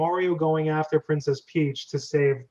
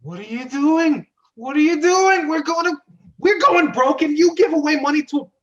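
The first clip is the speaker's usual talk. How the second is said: high, loud outraged shout; furious brother shouting about going broke; angry yell; 10 semitones higher, 7 dB louder than usual. Acted. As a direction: yell at him for giving money away. Mario yells at Luigi.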